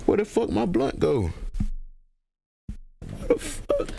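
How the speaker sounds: high-pitched, puzzled outburst; baffled alarm. Real, not acted. Host suddenly missing his blunt, baffled alarm. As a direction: pitch shoots up into a squeak of disbelief.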